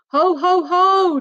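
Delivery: high, loud ho ho ho; hearty chuckle. Acted. Daddy Pig chuckling after mending the dinosaur, hearty ho ho ho. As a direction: chuckle proudly. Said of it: "chuckles Daddy Pig".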